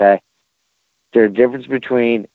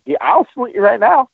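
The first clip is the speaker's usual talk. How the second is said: sarcastic; 12 semitones higher than usual. Retold or imitated. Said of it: "It's like".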